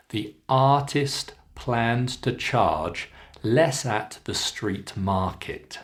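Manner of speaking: slow, long vowels in "artist", "charge", "market". Shown as a demonstration. Teacher models sentence three, slow long ah in "artist". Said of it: "keep the R sound nice and long".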